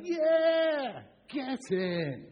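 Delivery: high, loud football-fan yell; cheering angels. Acted. Angels over the hillside celebrating like fans, jubilant shout. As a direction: start on a big drawn-out goal cheer. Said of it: "angels ... and they're going".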